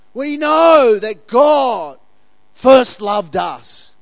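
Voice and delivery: slow, loud, stretched out; emphatic preaching. Real, not acted. Pastor to congregation stressing God's love, slow and loud. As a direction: land each word with weight.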